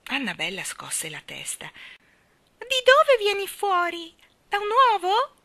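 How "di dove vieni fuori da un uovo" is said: put-on very high, loud baby-bird piping; chirping fledgling. A narrator's reading. Young bird questions the baby, shrill cheeping. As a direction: pipe it up high like a chick. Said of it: "pigolò all'improvviso l'uccellino".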